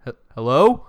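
nervous calling out. Retold or imitated. Calling to unknown footsteps upstairs.

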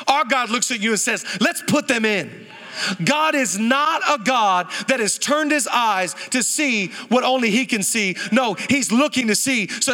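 preacher building to full force; preaching. Acted. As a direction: lift the room with conviction. Preaching encouragement to congregation.

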